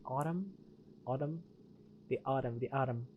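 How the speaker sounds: softly trying out an American pronunciation; trying American accent. Shown as a demonstration. Streamer working out how Americans say the car's name, tentative American accent. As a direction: test "Autumn" quietly in an American accent. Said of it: "like, in American accent".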